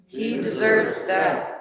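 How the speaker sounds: slow, grim pronouncement; lightly voiced crowd; council's verdict. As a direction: condemn him.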